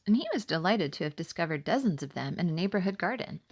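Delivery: ordinary delivery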